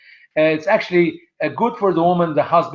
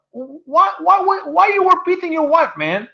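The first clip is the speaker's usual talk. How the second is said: much higher pitched, repeated nagging why; mock incredulous neighbour; 13 semitones higher than usual. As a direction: whine it like a nosy neighbour.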